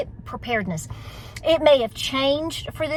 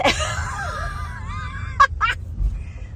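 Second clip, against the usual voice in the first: long, very high shrieking laugh; high squealing laugh. Real, not acted. Laughing at passers-by in the car.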